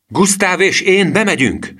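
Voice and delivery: high, slow, carrying yell; commanding high shout; shouted announcement. A narrator's reading. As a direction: yell the plan out to the troop. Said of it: "ordította".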